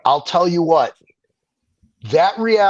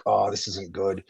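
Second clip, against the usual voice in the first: quiet dismay; 9 semitones lower, 9 dB quieter than usual. Retold or imitated. Retelling the moment his neck popped.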